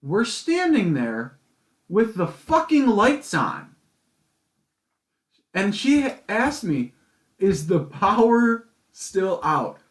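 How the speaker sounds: slow, loud, high-pitched incredulity; drawn-out, exasperated retelling; exasperated disbelief. Retold or imitated. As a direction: hammer each word in disbelief. Incredulous retelling of dumb question.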